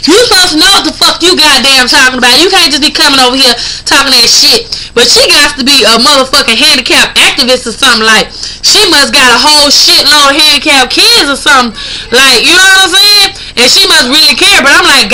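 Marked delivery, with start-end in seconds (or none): none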